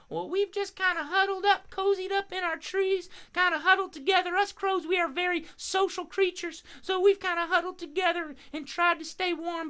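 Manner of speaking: very high, chatty bird voice; friendly crow puppet. Pretend. Crow telling host how birds keep warm, high puppet voice. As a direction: explain things as a little cartoon crow.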